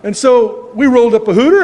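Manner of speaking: ordinary delivery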